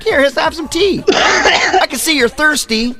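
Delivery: hammed-up host slipping him mushroom tea; sly friend offering tea. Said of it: "He's like".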